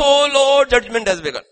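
high, loud cry of dread, easing at the end; panicked outcry. Acted. Frightened masses see fire come, hammed-up panicked cry. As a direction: wail it like a terrified crowd.

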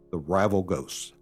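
ordinary talk, nothing marked

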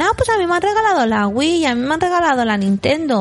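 light, high-pitched child impression; imitated schoolkids. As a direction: show off like a kid at school. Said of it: "los niños dicen".

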